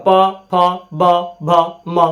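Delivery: प, फ, ब, भ, म one by one, lips closing; lip-sound demo. Shown as a demonstration. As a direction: press both lips for प, फ, ब, भ, म. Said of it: "ओठवा दोनों सट रहा है". (प, फ, ब, भ, म दोनों होंठ सटाकर)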